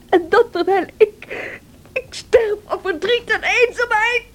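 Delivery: sobbing self-pity; tearful. Acted. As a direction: let the tears break through. Cathy breaks down in grief.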